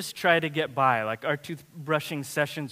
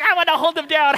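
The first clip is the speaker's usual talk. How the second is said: put-on excited little girl; 10 semitones higher, 8 dB louder, faster than usual. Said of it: "she's just excited".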